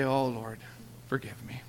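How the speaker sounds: soft, hushed plea for forgiveness; whispered prayer. Retold or imitated. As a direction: breathe it out as a humble whisper to God. Repentant prayer voiced in story.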